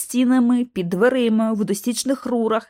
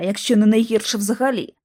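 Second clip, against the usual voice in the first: ordinary delivery, nothing marked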